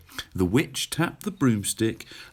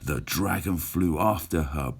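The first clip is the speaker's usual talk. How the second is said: usual speaking voice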